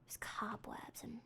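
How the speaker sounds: hushed eerie whisper. Real, not acted. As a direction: whisper it like you might wake ghosts. Eerie abandoned house.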